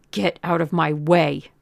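loud, hard command; angry order. A narrator's reading. Gunman ordering the girl aside, angry. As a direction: order it forcefully, without raising the pitch.

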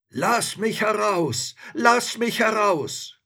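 high, loud, drawn-out cry to be freed; trapped creature calling. A narrator's reading. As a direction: call slowly and loudly from inside a bottle. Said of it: "rief's vom Neuen".

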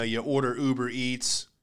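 normal talking voice

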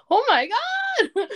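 very high, loud, drawn-out fangirl shriek; excited squeal. Retold or imitated. Fangirl finding fellow fans at school, excited squeal. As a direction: squeal with joy. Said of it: "And I was like".

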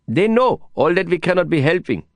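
high, lilting Indian English voice; Prabhakar's voice. A narrator's reading. Prabhakar pleading for the handlers' bear, high and earnest. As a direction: carry his worried plea high and earnest.

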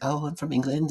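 high, put-on British accent; light mock-British voice; British girl's accent. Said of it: "That was my British accent".

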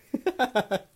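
high, loud giggling burst; real laugh. Real, not acted. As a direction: laugh at your own cleverness. Laughs at sounding smart.

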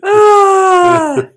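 loud, high, drawn-out "ah"; exasperated wail. Real, not acted. Partner flustered after mixing up quotes, loud wail. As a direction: groan out long in frustration.

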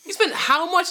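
high, loud incredulous laughing question; real laugh of disbelief. Real, not acted. Laughing at corporate brag.

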